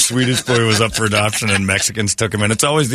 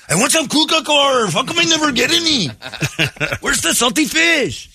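much higher whiny Swedish lilt; mock Swedish accent; 12 semitones higher than usual. Swedish adoptee begging for sausage and fish, high mock Swedish lilt. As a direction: whine for Swedish food.

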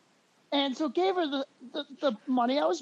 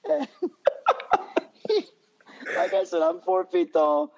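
ordinary delivery

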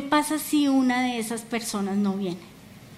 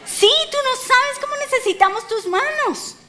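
very high, insistent exclamation; emphatic rebuttal; 12 semitones higher than usual. Real, not acted. Preacher answering a doubting believer about their hands, emphatic rebuttal. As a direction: counter it with soaring, high-pitched urgency.

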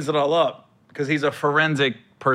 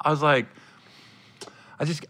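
normal talking voice

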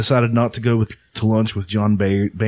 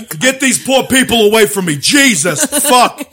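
higher, louder mock rant; mock outrage; 11 semitones higher, 7 dB louder, slower than usual. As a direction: ham up a disgusted politician. Mocking angry politician.

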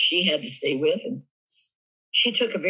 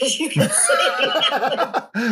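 laughing; 11 semitones higher, 5 dB louder, much slower than usual. Real, not acted. Laughing at own quiet life.

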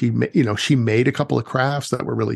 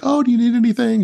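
much higher and louder, fast and sing-song; hammed-up mom voice; 11 semitones higher, 4 dB louder, faster than usual. Storyteller mimicking a mom fussing over her daughter, high and fast. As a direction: play the overly sweet worried mom, pitched way up. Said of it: "and she was like".

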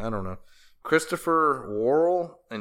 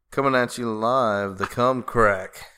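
punchy live-broadcast announcer, slowing on the name; mock announcer; 4 dB louder than usual. Host launching a new slang term, mock announcer. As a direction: announce it like a hype host, drawing out the last words.

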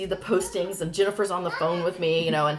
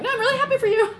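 high, loud overeager congratulations; hammed-up cheer with a laugh underneath; forced happiness; 7 dB louder, faster than usual. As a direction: gush too hard to cover panic. Fake congratulations while freaking out.

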